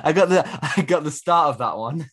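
laughing through the words; chuckling while saying bless you. Real, not acted. Laughing at friend's sneeze.

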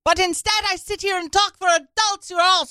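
high, loud mock outrage; imitating Greta Thunberg. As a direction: rant like an outraged teen activist. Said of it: "Greta Thunberg saying".

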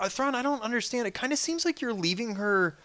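high-pitched, mock-bewildered questioning; puzzled. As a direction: pitch it up, tentatively querying him. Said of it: "I was kind of like".

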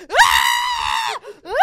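two high, loud shrieks; piercing re-enacted scream of horror; top-of-the-lungs scream. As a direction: shriek like a theater full of fans. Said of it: "screaming at the top of our lungs".

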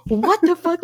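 high, loud retold disbelief; shocked outburst. Recalling seeing her roommate through the window, shocked outburst. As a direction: blurt it like you just saw something mortifying.

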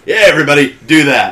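a loud, careless go-ahead to the whole cast; mock director order. De Palma waving the whole cast on, hammed-up and loud.

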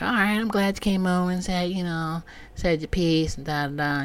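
lightly voiced calming neighbor; calmer mock neighbor. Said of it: "She's like".

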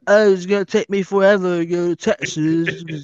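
griping in a mock English accent. Host mimics travelers complaining about Texas distances, mock English accent. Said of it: "but with an English accent".

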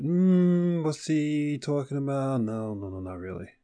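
slow, puzzled mock doubt, trailing off very quietly; mock puzzled mumble fading away. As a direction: imitate a skeptic who mutters and fades out. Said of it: "Some of you even went".

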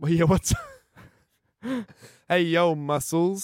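slow, high, loud laughing bro voice; mock tough-guy greeting. Greeting the cohost as Muscles, hammed-up bro voice. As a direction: greet like a gym bro hamming it up.

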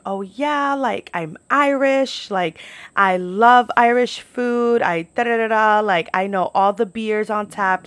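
slow, gushing imitation of excitement; mock excited. Girl at the Irish restaurant on Saint Patrick's Day, mock excited. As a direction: stretch the words in hammed-up enthusiasm. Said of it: "so excited to be there".

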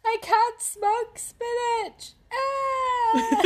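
high, slow crying wail; mock sobbing despair. Exhausted person lamenting about spinach, high slow sob. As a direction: wail it tragically.

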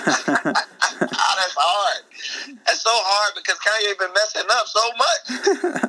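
high-pitched, laughing through the words; laughing protest. Real, not acted. Rapper question from interviewer, answered with amused, laughing protest. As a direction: crack up while protesting a tough choice.

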